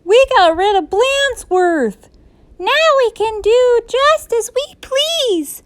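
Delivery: put-on high-pitched jubilant kids; excited cry. A narrator's reading. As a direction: celebrate like children set free. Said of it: "cried the class".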